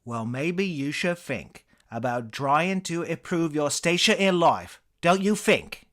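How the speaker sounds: put-on scolding British accent, high and loud; angry English comedian, building in volume; Eric Idle. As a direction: tell them off in a British accent. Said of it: "sensing the anger in his eyes".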